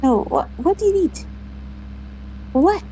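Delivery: high sing-song pet voice. Real, not acted. Owner fussing over the dog Daisy, high baby talk. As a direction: coo at the dog.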